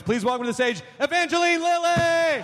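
big rising stage announcement; podium speech. Acted. Announcing guest to audience.

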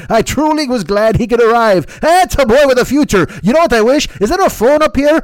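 loud, hammed-up Irish old pa; Irish accent. Dead man drinking whiskey with famous Irishmen up here, hammed-up Irish accent. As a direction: play the dead father with a broad, boisterous Irish accent. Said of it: "doing this voice from the afterlife of the dead man".

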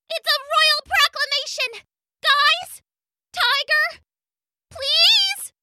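very high bug voice, begging; pleading gnat voice. Acted. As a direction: whine for help, getting desperate.

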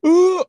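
high, loud drawn-out "ooh"; mock huffing wail; huffing and puffing. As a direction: imitate a huffy outburst, high and loud. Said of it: "the huffing and puffing to".

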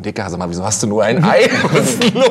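mocking friend laughing on the phone; laughing mockery. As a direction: laugh through the teasing question.

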